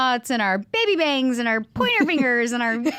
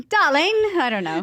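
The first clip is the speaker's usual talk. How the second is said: Australian-ish accent; 5 semitones higher than usual. Shown as a demonstration. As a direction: put on a wobbly Australian accent. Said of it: "kinda Australian-y and I'm like".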